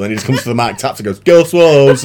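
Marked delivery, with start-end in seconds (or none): 1.2-2.0 higher, louder name call; mock announcer voice. Repeated quote at the mic.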